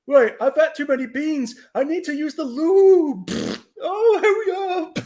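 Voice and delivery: put-on high, strained old man in a rush; creepy old man voice. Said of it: "he's like".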